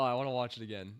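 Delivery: usual speaking voice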